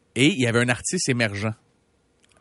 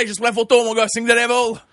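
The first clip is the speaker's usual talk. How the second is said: excited photo request; 8 semitones higher, 5 dB louder than usual. Retold or imitated. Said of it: "je dis".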